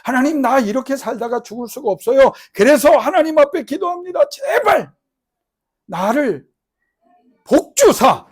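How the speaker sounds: building to a loud, high cry for blessing; desperate prayer. Acted. Jabez praying to God, rising to a loud cry. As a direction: plead harder with every line. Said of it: "그랬어요".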